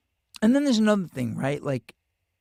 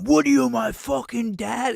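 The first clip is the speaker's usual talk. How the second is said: higher, louder mocking snarl; angry retort; 11 semitones higher, 5 dB louder than usual. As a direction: fire it back like a furious hothead. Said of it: "And he went".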